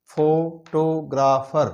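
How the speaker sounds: Photographer whispered slowly, syllable by syllable. Shown as a demonstration. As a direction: breathe out photographer one syllable at a time. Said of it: "इसमें भी चार syllable हैं". (Photographer को धीमी फुसफुसाहट में syllable-syllable बोलना)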